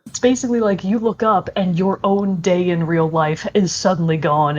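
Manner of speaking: ordinary talk, nothing marked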